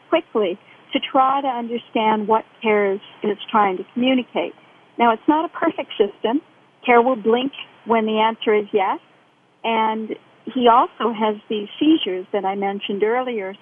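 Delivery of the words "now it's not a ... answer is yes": ordinary talk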